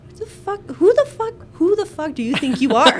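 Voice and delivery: high, loud indignant shout; hammed-up furious yell; mock outrage. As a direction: blow up in outraged disbelief. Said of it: "And I'm like".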